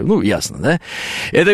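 usual speaking voice

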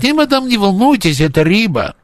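put-on high old Odessa woman, Odessa accent; unruffled Odessa granny with Odessa accent; Odessa Russian accent. Одесская старушка отвечает даме. (одесский говор старушки)

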